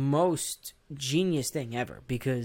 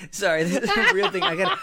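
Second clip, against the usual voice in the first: words squeezed out through high laughter; laughing hard; 7 dB louder than usual. Real, not acted. Host apologizing to cohost mid laughing fit, high and breaking. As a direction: try to talk while cracking up.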